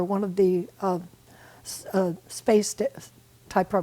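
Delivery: halting, broken-off false starts; stuttering search for a term; stumbling over words. Real, not acted. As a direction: grope for the word and keep breaking off. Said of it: "I'm stuttering".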